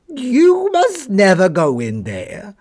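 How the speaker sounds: high, slow, gruff dog warning; a dog growling a warning at the snowman; yard dog voice. Acted. Yard dog warns the snowman away, high and slow. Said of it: "said the yard dog".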